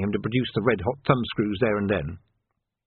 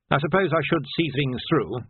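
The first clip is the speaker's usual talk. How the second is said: ordinary delivery, nothing marked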